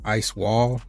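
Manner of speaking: normal talking voice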